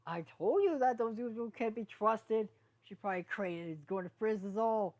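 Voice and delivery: angry accusation. Acted. Sprout blaming unicorns for the storm, loud angry shout. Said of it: "Sprout commented".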